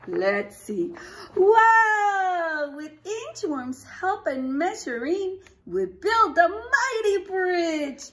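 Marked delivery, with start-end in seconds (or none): 1.4-8.0 high, loud triumphant story climax; excited cheer. Acted. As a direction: celebrate the mighty bridge. Story climax read to kids.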